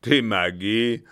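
loud, disbelieving echo; scornful captain. Acted. Captain echoes the German's answer with loud contempt. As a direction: throw the word back with contempt.

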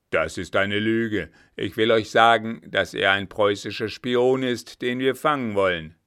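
loud, forceful officer; stern accusation. A narrator's reading. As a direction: declare it with hard authority. Officer accuses the innkeeper, reveals spy. (laut und energisch)

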